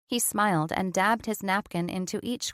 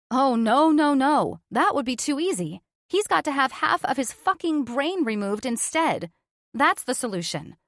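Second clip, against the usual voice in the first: louder, higher, sarcastic rant; bitter outburst; 5 semitones higher, 4 dB louder than usual. Acted. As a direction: spit the sarcasm out, voice climbing. Jessica's bitter outburst about surgery.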